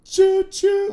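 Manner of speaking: high loud choo choo; train whistle. Pretend. Mimicking the passing train, high and loud choo choo.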